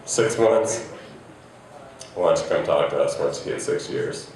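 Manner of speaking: low-pitched mock condescension; condescending. Couples talking down to newer couples, condescending and low. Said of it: "that say condescending shit like".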